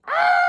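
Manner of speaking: very high, loud mock shriek; hammed-up high scream. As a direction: let out a high fake scream.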